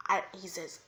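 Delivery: plain reading voice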